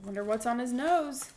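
lively storybook reading voice; reading to children. Acted. Teacher reading a picture book aloud to her boys, animated. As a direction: read it out like story time for little kids.

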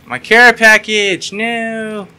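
high, loud, very slow wail. Real, not acted. Gamer wailing as his care package is lost. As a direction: stretch the wail out.

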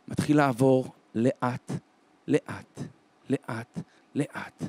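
low, slow, spaced-out repeats; slow suspense. A narrator's reading. Narrator follows the German down the line, slow suspense. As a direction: let each step fall slowly, sinking away.

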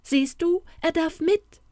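put-on girl rejoicing that Toni may come; jubilant cry. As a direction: cheer like a delighted little girl. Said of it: "jauchzte sie".

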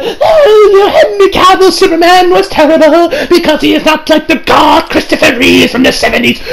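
high, loud sneering parody; mocking critics. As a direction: mimic the whiny Reeve fans. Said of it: "they're like".

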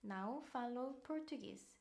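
very slow "Não falo português"; carefully drawn-out model phrase; slow demo pronunciation. Shown as a demonstration. Teacher modelling a phrase for tourists, very slow. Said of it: "라고 물어볼 때는".

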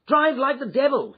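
high, loud urgent shout; shouted order. A narrator's reading. Hurried gentleman to his cabman, shouting. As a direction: call out urgently to the driver. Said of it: "he shouted".

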